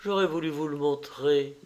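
ordinary delivery

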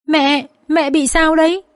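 high, slow, acted crying voice; tearful. A narrator's reading. Dương, pulled by the hair, cries to her mother, tearful. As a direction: plead tearfully, drawing the words out. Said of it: "bật khóc và nói".

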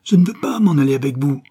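put-on high, loud frightened cry of a small boy; frightened boy yelling to be let go; child crying out. A narrator's reading. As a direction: shout it out, scared and angry. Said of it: "il se mit à crier".

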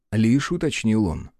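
ordinary delivery, nothing marked

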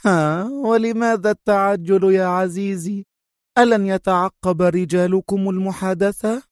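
high, teasing villainess voice; amused mockery. A narrator's reading. Sonia teases Adham about tracing the call, amused mockery. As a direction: toy with him in a high, coy voice. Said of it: "أطلقت ضحكة أخرى قبل أن تقول".

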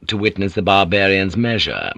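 plain reading voice

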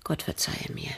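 soft whispered plea. Acted. Widow whispering a plea for God's forgiveness. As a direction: whisper it like a prayer. Widow ending her bitter confession.

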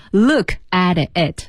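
slow "look at it", the t of "at" voiced toward d; linked t voicing. Shown as a demonstration. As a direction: demonstrate "look at it" with "at" softened to "ad". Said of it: "是不是要把特浊化".

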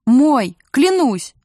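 loud, forceful exclamations; desperate insistence. A narrator's reading. Captive mother insists to her kidnappers, loud and desperate. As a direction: swear it urgently, voice jumping up. Captive swears child is hers.

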